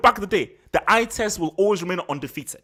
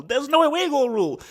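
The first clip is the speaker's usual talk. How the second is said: emphatic exclamation; 7 semitones higher, 5 dB louder than usual. Real, not acted. Repeats the realisation.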